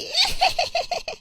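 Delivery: put-on very high-pitched girlish cackle; gleeful, jeering peal of laughter; mocking cackle. A girl laughs at her bald classmate, shrill mocking cackle.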